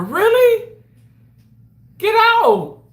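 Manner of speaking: high, loud squeal of surprise; amazed disbelief. Real, not acted. Streamer reacts to chat news, amazed and high. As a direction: exclaim as if you can't believe the news.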